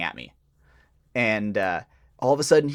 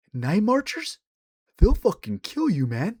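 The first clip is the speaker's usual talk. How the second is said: imitated local warning, pitched up with a rising question; so-serious crackhead; 8 semitones higher, slower than usual. Crackhead warning storyteller about Night Marchers, mock deadly serious. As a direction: play the deadly serious stranger giving a warning. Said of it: "he immediately goes so serious".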